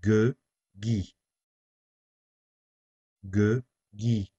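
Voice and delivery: "G, gi" with a hard G as in give. Shown as a demonstration. Teacher showing how the U keeps the G hard. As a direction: say "gi" with the U keeping the G hard. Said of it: "we add the U before the E or the I".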